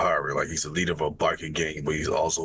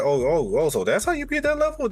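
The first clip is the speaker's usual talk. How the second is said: amazed viewer; 9 semitones higher than usual. Retold or imitated.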